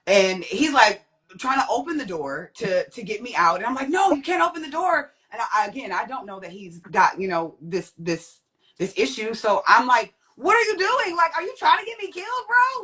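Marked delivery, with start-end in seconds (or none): 3.9-5.1 high-pitched retold urgent protest. Retold plea to stop man. 10.4-12.8 high, rapid incredulous retelling; incredulous protest. Retold or imitated. Retold confrontation with confused man.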